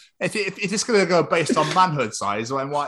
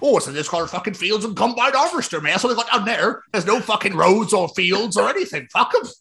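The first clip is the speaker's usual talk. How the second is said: higher, scornful Bristol yokel; mock Bristol accent; 6 semitones higher than usual. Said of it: "when they wanna do a Bristol or Southern accent".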